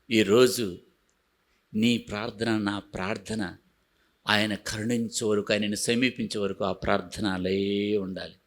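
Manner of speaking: quiet pleading sermon voice; hushed exhortation. Acted. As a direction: press it gently on each listener. Earnest intimate exhortation.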